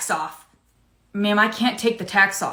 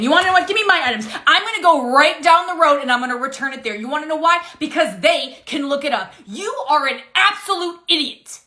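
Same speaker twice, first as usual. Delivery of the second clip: high, heated tirade; angry customer; 5 semitones higher than usual. Acted. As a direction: huff off, insulting the cashier.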